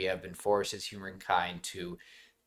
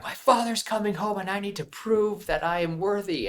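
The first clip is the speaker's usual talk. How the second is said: high, strained mock yell of a frustrated cadet; half-acted outburst, pitched well up; retold scream; 10 semitones higher than usual. Cadet retelling his outburst at the officer, mock scream. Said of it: "screams at him".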